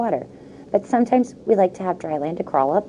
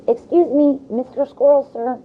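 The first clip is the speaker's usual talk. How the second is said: higher, louder calling out; calling over; 9 semitones higher, 5 dB louder than usual. Acted. Shy turtle calling to squirrel, louder call. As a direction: call across the room politely. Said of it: "I called over".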